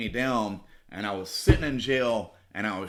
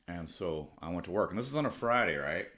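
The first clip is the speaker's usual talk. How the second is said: ordinary talk, nothing marked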